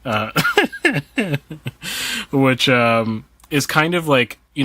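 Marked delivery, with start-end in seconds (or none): 0.3-2.3 laugh. Real, not acted. Laughing at own pop-culture tangent.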